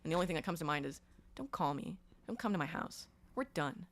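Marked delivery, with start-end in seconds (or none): 1.4-3.9 quiet. Retold or imitated. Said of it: "it's really quiet".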